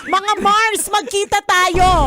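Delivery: high, drawn-out mock plea to girlfriends; woman calling her girlfriends. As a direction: imitate a woman rallying her friends. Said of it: "Parang babae lang talaga yung ano".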